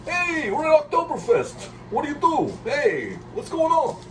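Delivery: high-pitched, loud caricature of Italian tourists; Italians at Oktoberfest. Acted. Mocking Italians trying to go to Oktoberfest, high excited caricature. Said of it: "it'd be like the Italians trying to".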